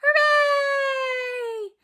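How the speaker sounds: very high, loud hooray; big cheer. Shown as a demonstration. Leading kids at home to yell hooray, high and loud. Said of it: "Can you yell hooray?".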